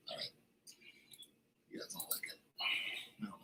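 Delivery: breathy aside, barely voiced; whispering. Real, not acted. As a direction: whisper it to yourself. Muttering aside to viewers.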